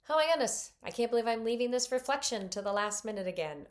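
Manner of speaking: a hint of mock self-exasperation, quick; frustrated with myself. Preacher voicing her own frustration, lightly exasperated. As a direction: sigh it out at your own slip. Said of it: "get so frustrated with ourselves".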